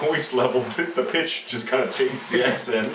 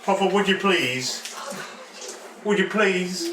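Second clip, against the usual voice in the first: put-on childish pleading; 6 semitones higher than usual. Shrunken player begs the captain.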